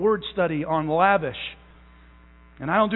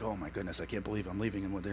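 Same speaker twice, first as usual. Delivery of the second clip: much lower and softer, fast muttered dread; mock worried aside; 13 semitones lower, 14 dB quieter, faster than usual. Parent walking away from Johnny, fretting under breath. Parent's inner worry leaving child.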